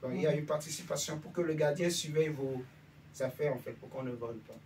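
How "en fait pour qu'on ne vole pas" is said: ordinary talk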